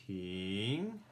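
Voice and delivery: Ping with the pitch climbing; rising tone. Shown as a demonstration. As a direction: let Ping rise clearly upward. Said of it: "sounds like it's going up".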